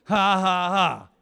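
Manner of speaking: a preacher modeling a forced laugh; forced laughter. Shown as a demonstration. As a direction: laugh on purpose to show them how. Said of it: "Just laugh".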